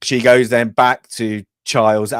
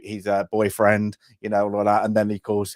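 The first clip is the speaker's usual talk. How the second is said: ordinary delivery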